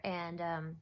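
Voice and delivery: usual speaking voice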